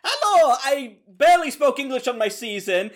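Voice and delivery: high, loud put-on Indian accent. Impersonating Jessica Wilde with a loud, high Indian accent. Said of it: "Why am I making her Indian?".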